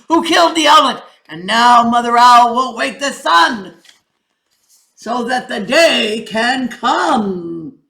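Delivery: loud, ringing king's proclamation; lion king. Acted. King addresses the council, loud and commanding. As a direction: announce the chain of blame.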